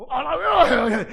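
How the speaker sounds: loud, high comic catchphrase; playful imitation. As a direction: shout it playfully like the man on TV. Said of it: "empecé a decir eso de". (imitación juguetona, grito agudo y cómico)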